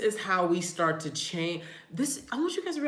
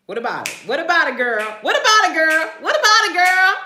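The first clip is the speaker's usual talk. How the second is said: hammed-up New Orleans sass, building louder and higher; New Orleans accent; 8 semitones higher, 12 dB louder than usual. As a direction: repeat it in New Orleans talk, building to a playful shout. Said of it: "That's how they talk".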